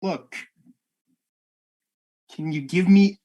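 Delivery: ordinary talk, nothing marked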